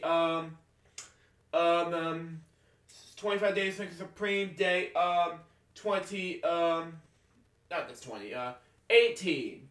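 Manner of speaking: upbeat YouTube announcer voice; host outro. Acted. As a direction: close the episode like a TV host. Wraps up episode outro.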